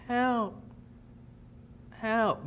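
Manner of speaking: high, small "help" from far off; a faint distant cry for help, imitated; faint call for help. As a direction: imitate a weak cry carried on the wind. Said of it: "like, a little".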